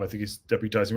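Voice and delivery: normal talking voice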